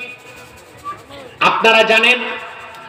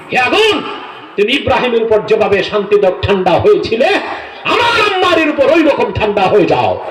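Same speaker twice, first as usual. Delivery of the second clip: ধমক. Retold or imitated. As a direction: order it like a rebuke, voice soaring on the opening and the final demand. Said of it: "আগুনকে ধমক দিলেন". (আগুনকে চড়া গলায় ধমক)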